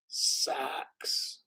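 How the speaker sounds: breathy stage whisper of a name; whispered disbelief. Real, not acted. Vlogger whispering to viewers in shock. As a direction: hush the word as if it's a secret. Incredulous at Goldman on team.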